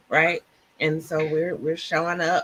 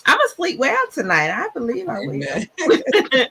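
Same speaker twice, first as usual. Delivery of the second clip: joyful; 8 semitones higher, 4 dB louder than usual. Real, not acted. Emotional joyful close of session.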